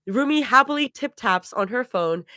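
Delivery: ordinary delivery, nothing marked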